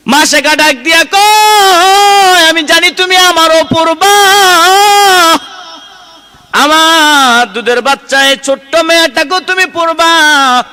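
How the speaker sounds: high, loud, long-drawn sermon wail; soaring waz chant at full force; impassioned preaching. Acted. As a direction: cry out the mother's words, stretching the vowels. Preacher cries out the mother's words.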